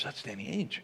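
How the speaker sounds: low hushed insistence; wife insisting under her breath. Retold or imitated. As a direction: insist quietly so he won't hear.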